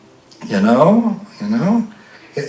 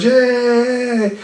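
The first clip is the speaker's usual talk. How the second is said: class cheers; 11 semitones higher than usual. Retold or imitated. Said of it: "the class cheers".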